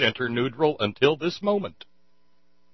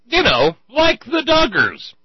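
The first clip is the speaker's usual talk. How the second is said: much higher, louder sarcastic jab; high mocking voice; 12 semitones higher, 7 dB louder than usual. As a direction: squeal it out mockingly.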